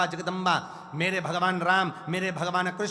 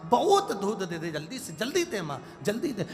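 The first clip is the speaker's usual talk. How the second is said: lightly voiced child, higher and urgent; a child begging its mother for milk; hungry child pleading; 6 semitones higher, 3 dB louder than usual. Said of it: "और बच्चा कह रहा है". (भूखे बच्चे की हल्की नकल)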